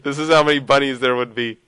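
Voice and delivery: said through giggles; amused, giggling delivery; laughing. Real, not acted. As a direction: laugh while getting the words out. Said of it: "I don't know why I'm laughing".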